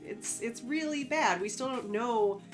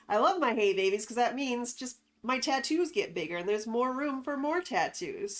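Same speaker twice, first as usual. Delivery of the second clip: ordinary talk, nothing marked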